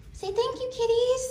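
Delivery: high sing-song voice for kittens. Real, not acted. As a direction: coo at the kittens in high pitch. Talking to the kittens.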